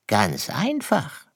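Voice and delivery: high, slow fox voice; storybook fox with a ready answer; smug fox. Acted. (selbstgefälliger Fuchs, hoch und langsam)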